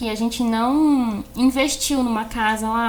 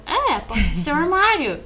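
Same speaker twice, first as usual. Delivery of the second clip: higher, emphatic explaining; oblivious self-quote; 5 semitones higher, 4 dB louder than usual. Retold or imitated. Her answering the teasing clients, oblivious insistence. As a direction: explain it insistently, missing the joke. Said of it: "eu não tava percebendo".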